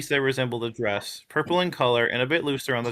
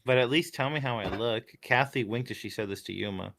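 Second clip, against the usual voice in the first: ordinary delivery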